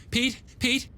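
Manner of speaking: high calls up the stairs, names drawn out; calling out. A narrator's reading. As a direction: call the children's names up the stairs. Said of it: "she called".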